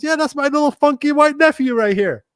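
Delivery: proud uncle introducing his nephew, in a high little voice. Retold or imitated. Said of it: "He goes".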